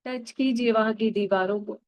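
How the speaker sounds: put-on calm guide drawing the listener back to childhood; guided meditation. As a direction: lead them gently into the memory. Guides inner-child visualization.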